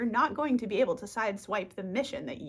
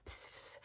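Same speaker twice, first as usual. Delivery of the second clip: psst; 19 dB quieter than usual. Retold or imitated. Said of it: "out there going".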